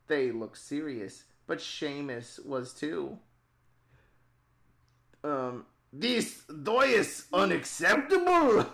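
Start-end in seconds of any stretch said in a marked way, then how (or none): 5.9-8.7 outraged yell at the council; angry shout. Acted. Character shouts in told story.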